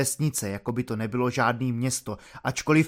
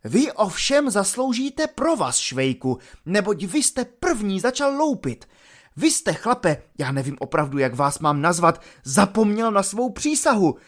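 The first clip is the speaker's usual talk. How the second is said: high, agitated scolding; outraged officer's tirade in a raised pitch; exasperated lieutenant; 6 semitones higher than usual. Acted.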